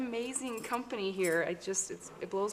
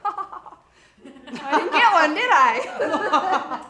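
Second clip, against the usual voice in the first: laughing; 5 semitones higher, 8 dB louder, much slower than usual. Real, not acted. Joking about no laughs.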